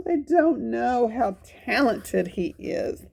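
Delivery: ordinary delivery, nothing marked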